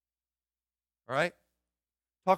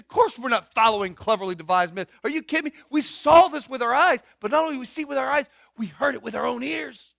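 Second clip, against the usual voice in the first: higher and louder, insistent; excited Peter; 6 semitones higher, 7 dB louder than usual. Acted. Peter insists to doubters he saw and heard it, high and loud. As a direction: insist on it like a stunned witness.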